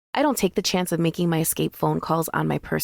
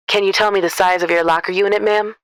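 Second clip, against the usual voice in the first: louder, smooth man's voice, polite; smooth trucker asking a caller a question; 3 semitones higher, 7 dB louder than usual. A narrator's reading. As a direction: ask it like a friendly working man. Trucker on phone.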